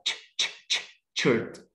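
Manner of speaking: "ش، ش، ش، شيرت" as voiceless puffs, then the syllable; sounded out. Shown as a demonstration. Teacher breaking down captured, slowly sounded "شيرت". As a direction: repeat the puff, then slowly land on "شيرت". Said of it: "اليو هنا بنطقها ايه؟".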